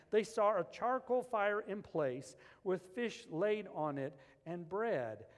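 loud, high, driving preacher voice; rising revival sermon delivery; preaching at full force. Acted. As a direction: preach it out to the back row.

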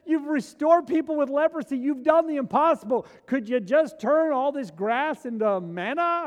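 loud, high, pleading plea to Jesus; loud, high desperate appeal; pleading cry. Acted. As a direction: cry out to him, begging for the impossible. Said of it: "turn to him and say".

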